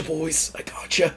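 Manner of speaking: unvoiced conspiratorial whisper; whispered reassurance. Grimes whispering to the passing prisoners, secretive. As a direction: let them know quietly you've got them.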